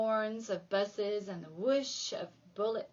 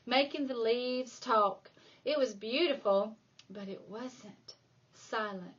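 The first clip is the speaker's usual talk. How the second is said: ordinary delivery, nothing marked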